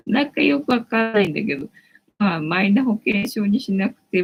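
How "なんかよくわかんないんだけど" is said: laughing through it, voice lifted; laughing repeat. Real, not acted. Streamer repeating her own line to viewers, laughing.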